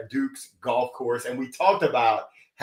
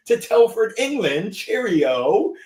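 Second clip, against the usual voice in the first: higher-pitched, hammed-up English accent; playful mock-English lilt, badly done; 7 semitones higher than usual. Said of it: "that's a really bad accent".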